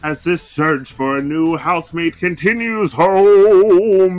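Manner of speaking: loud, building announcer voice; dramatic announcer outro. Acted. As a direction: tease the next episode big.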